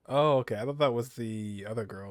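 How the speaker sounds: normal talking voice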